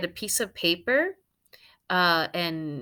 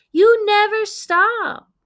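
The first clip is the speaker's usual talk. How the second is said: slow, high, loud exasperated yell; yelling mother; 13 semitones higher, 10 dB louder, slower than usual. Acted. Mother yelling at the talking dog, high and loud. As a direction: shout it, totally worn out. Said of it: "yelled Mother".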